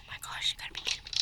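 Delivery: rapid breathless whisper; fast whisper. Real, not acted. Hiding, exasperated.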